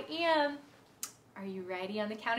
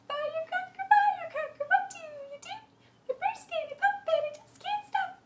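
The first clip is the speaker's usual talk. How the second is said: much higher and quick; high fast voice. Shown as a demonstration. Teacher to kids, chanting the rhyme high and fast. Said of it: "let's use a high voice and let's go really, really fast".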